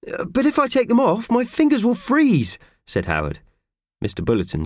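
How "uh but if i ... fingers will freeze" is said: pleading. Acted. Worker pleads.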